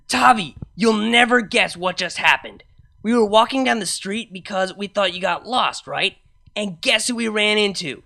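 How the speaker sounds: put-on high, loud excited drunk chatter. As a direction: gush the news, tipsy.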